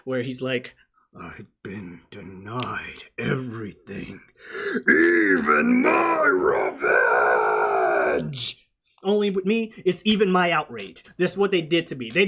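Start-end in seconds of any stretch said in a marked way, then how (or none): 1.1-8.6 deep, slow growl building from low to roaring; dramatic gargoyle voice swelling to a drawn-out shout; Goliath. Retold or imitated. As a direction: start low and measured, then bellow it out. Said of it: "he delivers one of my favorite lines".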